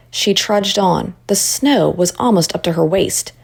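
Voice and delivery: usual speaking voice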